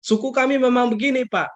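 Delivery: high-pitched imitated protest; mock excuse. Church member blaming the tribe to the pastor, high mock excuse. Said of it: "Ada orang juga bilang begitu".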